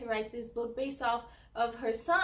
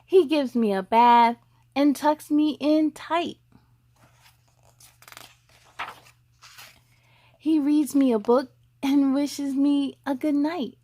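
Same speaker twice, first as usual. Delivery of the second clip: lively picture-book read, louder; reading to children; 10 dB louder than usual. Acted. Host reads a boy's story to young viewers, louder storybook voice. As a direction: read it out to the little ones, bright and full.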